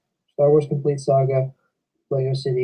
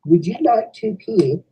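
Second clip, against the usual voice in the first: higher-pitched Australian imitation; Australian accent; 7 semitones higher than usual. Vlogger relaying his friend's invitation, Australian accent. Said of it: "I should do his accent".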